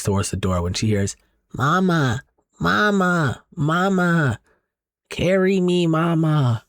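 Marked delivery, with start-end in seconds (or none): 1.5-6.6 put-on high, thin toddler voice calling from below; eerie baby voice. A buried toddler calls up to her mother, high eerie baby voice. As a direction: sound like a ghostly two-year-old pleading. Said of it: "the two-year-old responding to her".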